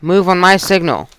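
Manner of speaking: loud order. Acted. Captor giving orders on plane.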